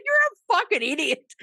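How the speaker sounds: very high, loud laughing insult; hammed-up yelling at the caller; insulting the scammer, high and loud; mock insult.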